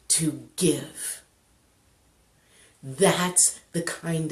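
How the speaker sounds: soft, slow, with long weighted pauses; solemn inspirational close. Real, not acted. Storyteller drawing the lesson about giving, hushed solemn delivery. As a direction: land each phrase gently and let it rest. Closing moral after the story.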